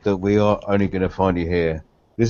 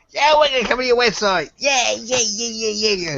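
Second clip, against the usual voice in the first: higher mock cheering crowd; 12 semitones higher than usual. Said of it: "everyone just goes".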